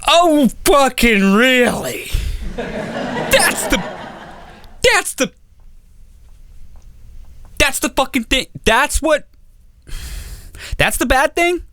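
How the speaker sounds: high, laughing incredulity; can't believe that's the worst flaw; squeaky, amused disbelief; incredulous. Real, not acted. Mock outrage at trivial flaw.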